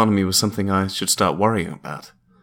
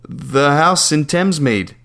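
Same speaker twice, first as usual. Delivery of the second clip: slow and higher, spelling it out; slow, patient repeat; 5 semitones higher, slower than usual. Acted. Caller repeating himself to the landlady, slowly. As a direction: say it slowly for someone who won't hear.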